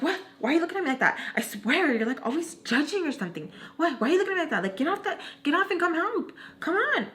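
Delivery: high-pitched exasperated nagging; mock scolding. Retelling her demand to get off and help, mock scolding. Retold scolding by annoyed woman.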